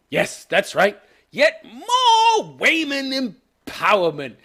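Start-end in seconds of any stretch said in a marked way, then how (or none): 1.3-4.4 slow, higher sneering aside; sarcastic drawl. Real, not acted. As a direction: roll your eyes as you say it. Sarcastic remark on the show.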